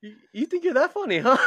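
high, loud comic challenge through laughter; mock scolding mom. Mom doubting her kid's comedy career, mock scolding. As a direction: challenge the kid in a hammed-up scolding.

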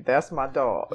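ordinary talk, nothing marked

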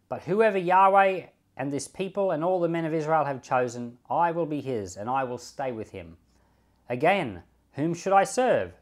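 measured, formal Bible reading; a pastor reading the chapter aloud to his flock, steady and formal; scripture reading. Acted. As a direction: read the passage aloud to the congregation. Reads the scripture passage aloud.

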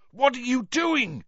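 high alarmed yell. Acted. Cedric yelling at Crumb in the dark, high yell. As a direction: shout it out in alarm. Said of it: "yelled Cedric's voice".

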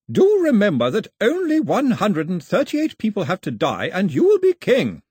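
put-on high, loud haughty old lady; imperious grandmother. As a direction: look down your nose at him. Grandmother pressing royal claim.

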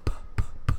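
popped p-, p-, p- into the mic; popping p sounds. Acted. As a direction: hit each p-, p-, p- hard into the mic. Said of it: "really testing out that pop filter there".

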